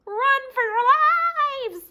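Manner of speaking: high, loud singsong alarm; panicked cry. Acted. Chicken Little warning the birds, loud high alarm. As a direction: yell the warning to run.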